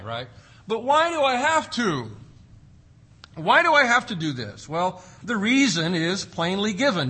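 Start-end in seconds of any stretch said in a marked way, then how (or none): 0.7-4.7 higher, louder mock child whine; whiny child. Child asking parents why they must obey, mock whine. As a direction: plead like a sulky kid.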